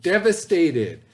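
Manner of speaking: devastated said slowly and clearly, each syllable distinct; slow word model. Shown as a demonstration. Teacher models the correct reading for students, slow and clear. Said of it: "Why don't you guys try and read that?".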